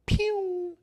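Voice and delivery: sharp high pew gunshot noise; mock gunshot. Shown as a demonstration. Comic mimicking a gunshot at someone. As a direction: fire off a high cartoon pew. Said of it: "shoot them like this".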